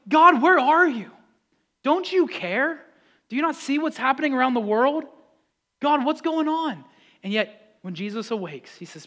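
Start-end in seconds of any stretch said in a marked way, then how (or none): 0.1-6.8 high, raised plea to God; frustrated, pleading questions; anguished outcry. Acted. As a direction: call out as if God isn't listening. Acted desperate cry to God.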